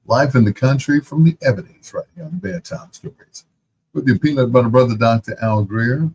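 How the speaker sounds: loud, upbeat DJ intro read; radio announcer. Acted. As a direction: sell the show like a late-night radio host. Radio host announcing the show.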